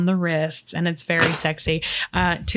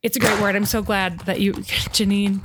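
usual speaking voice

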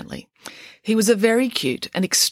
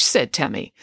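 plain reading voice

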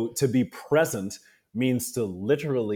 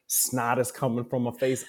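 ordinary delivery

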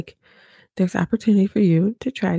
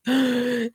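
mock gasp; 6 semitones higher, 4 dB quieter than usual. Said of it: "I literally just started going crazy".

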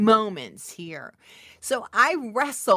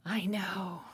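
knowing whisper; 3 semitones lower, 7 dB quieter than usual. Real, not acted. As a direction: whisper it as an aside. Knowing aside to the listeners.

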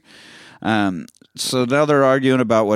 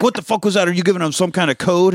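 higher, startled hammed-up question; mock alarmed; 8 semitones higher than usual. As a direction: play the robbers thrown off guard. Robbers react in alarm.

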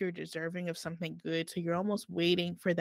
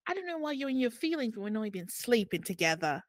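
slightly raised put-on British voice; British accent; 4 semitones higher, faster than usual. Boyfriend to Thisbe during their argument, in a British accent. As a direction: play the British boyfriend brushing her off. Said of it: "I'm gonna do a British accent".

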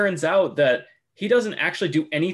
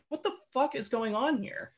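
much higher, softer mock bewilderment; retold baffled outburst, pitched way up; incredulous disbelief; 10 semitones higher, 5 dB quieter than usual. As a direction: pitch jumps up in disbelief at the casting. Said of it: "I'm like".

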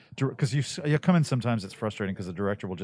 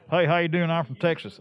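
higher-pitched Texas accent; 6 semitones higher than usual. Shown as a demonstration. Showing how anybody can do it on request, Texas accent. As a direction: put on a Texan hello. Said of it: "just do a Texas accent".

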